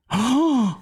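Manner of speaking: sharp loud mock gasp; big theatrical gasp; shocked gasp. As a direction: gasp in horror. Donald shocked by ghost.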